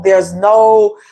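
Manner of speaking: ordinary delivery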